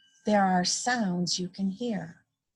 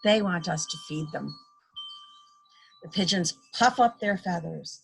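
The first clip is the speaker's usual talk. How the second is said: ordinary delivery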